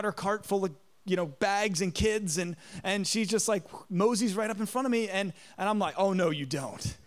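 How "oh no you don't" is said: lower, slow, laughing mock warning; a teasing warning through a laugh. Blocking line-cutter, laughing.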